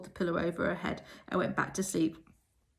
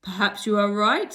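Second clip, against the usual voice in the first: loud sudden voice from behind; Miss Harbroom; 3 semitones higher, 9 dB louder than usual. A narrator's reading. Form mistress surprising the girls: loud sudden voice. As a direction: startle them from behind. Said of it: "Miss Harbroom's voice from behind".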